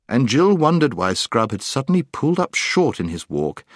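usual speaking voice

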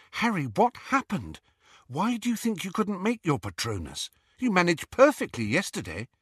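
put-on anxious, urgent girl's voice; Hermione voice. A narrator's reading. Hermione voiced, worried questions.

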